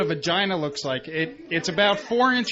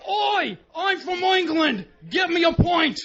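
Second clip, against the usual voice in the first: put-on high, shouted Cockney English accent; bad English accent shouted high; 8 semitones higher, slower than usual. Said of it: "I can do an impression of any country".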